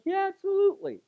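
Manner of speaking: high, bright eager agreement; eager yes. Retold or imitated. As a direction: jump at the chance to say yes.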